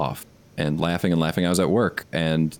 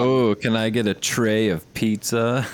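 put-on Northeastern Pennsylvania accent; 5 semitones higher than usual. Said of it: "trying to flaunt a Northeastern Pennsylvanian accent".

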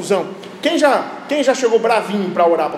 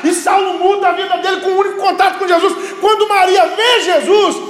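high, driving pulpit voice; fervent preaching; 8 semitones higher, 5 dB louder than usual. Real, not acted. Preacher retelling Saul meeting Jesus, fervent preaching. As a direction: preach it out with raised pitch.

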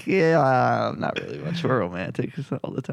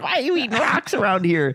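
scolding mom; 12 semitones higher than usual. Retold or imitated. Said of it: "She's like".

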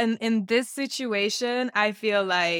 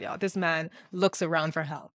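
normal talking voice

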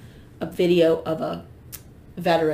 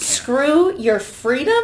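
incredulous; 6 semitones higher, 4 dB louder, much slower than usual. Real, not acted. Incredulous repeat of quote.

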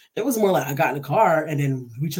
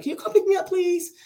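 high-pitched whimpering request; imitated tearful plea; 11 semitones higher than usual. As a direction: act out begging a sister for rescue. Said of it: "I'm crying like a little bitch".

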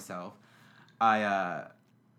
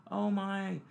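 high, lightly voiced worried friends; mock worried friends; 9 semitones higher than usual. Friends fretting over drunk Jonathan, higher voice. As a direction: raise the pitch, friends fretting. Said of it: "They're like".

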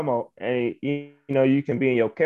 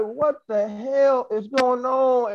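higher and drawn out slowly, exasperated; mock outrage; 8 semitones higher, much slower than usual. Losing the contract to his friend, slow exasperated. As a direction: stretch out the disbelief like you got robbed. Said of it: "but I was pissed off".

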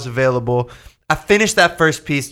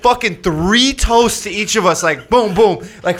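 ordinary talk

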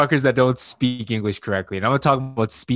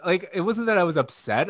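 ordinary delivery

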